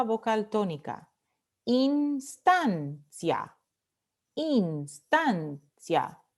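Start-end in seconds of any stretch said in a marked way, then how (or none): 1.7-6.2 "Instancia" slowly split into ins, TAN, cia; syllable by syllable. Shown as a demonstration. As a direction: sound out "instancia" slowly, stressing the middle TAN. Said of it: "penúltima sílaba".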